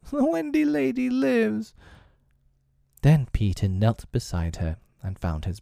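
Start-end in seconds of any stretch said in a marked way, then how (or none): 0.1-1.7 very high-pitched character voice; character's exclamation. Acted. Announcing that the Wendy lady lives, very high-pitched character voice. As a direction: call it out in a high, thin head voice.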